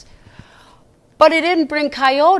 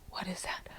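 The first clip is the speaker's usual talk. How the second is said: whisper; 6 semitones lower, 23 dB quieter, faster than usual. Real, not acted. Teacher prompting the kids.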